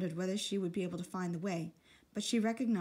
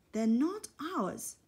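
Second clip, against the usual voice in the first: slow, hesitant and unsure; doubtful; 7 semitones higher, 4 dB louder, much slower than usual. Acted. Peter doubting Susan's plan to borrow the coats, slow and hesitant. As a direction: drag it out, not convinced. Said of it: "said Peter doubtfully".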